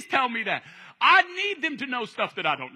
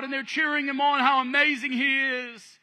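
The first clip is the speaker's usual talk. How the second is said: ordinary talk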